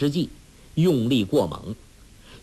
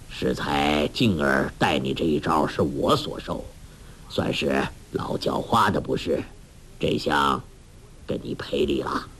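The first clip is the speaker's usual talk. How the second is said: put-on much lower old man's voice, measured apology; Hong Qigong; 9 semitones lower than usual. A narrator's reading. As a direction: step in to smooth things over, deep and unhurried. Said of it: "插口说道". (低沉沉稳地赔礼)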